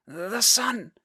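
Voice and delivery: put-on high hoarse boy whisper. A narrator's reading. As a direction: rasp it weakly. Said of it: "he whispered hoarsely".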